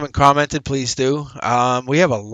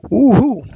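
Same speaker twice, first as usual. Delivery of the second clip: high, loud two-note woo-hoo; excited whoop; 10 semitones higher, 5 dB louder than usual. Real, not acted. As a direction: whoop it out, delighted with the cards. Reader cheers the card reading.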